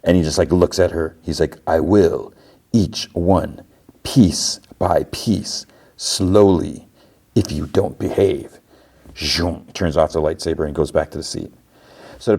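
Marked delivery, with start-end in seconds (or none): 1.7-8.6 measured, word-by-word retold threat; menacing threat. Jod looks at cringing Fern, slow menace. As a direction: draw out the threat with pauses.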